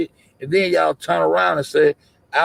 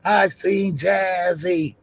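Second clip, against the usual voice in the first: high, slow mock witness; mocking imitation; 6 semitones higher, much slower than usual. Streamer mocks the same claimed sighting, imitating the witness. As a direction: drag the claim out, pitched up. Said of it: "you say you ain't seen shit".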